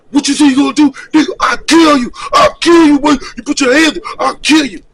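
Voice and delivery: loud, high, enraged shouting; furious threats. Retold or imitated. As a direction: yell the death threats in his face. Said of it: "he opened up".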